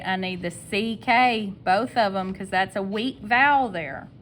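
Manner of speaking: normal talking voice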